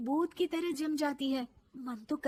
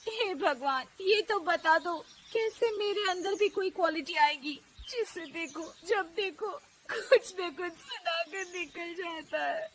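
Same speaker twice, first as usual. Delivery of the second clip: higher-pitched, whimpering plea; tearful wailing; 5 semitones higher than usual. Acted. Daughter-in-law complaining to God under the open sky, tearful. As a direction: lament that breaks into crying. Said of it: "वो रोने लगती है".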